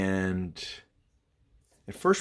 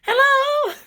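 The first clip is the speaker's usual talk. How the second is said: put-on high, loud childish call with a laugh; kid's voice; 10 dB louder than usual. Imitating Bobby calling like a kid into the house. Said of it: "He sounded like a kid".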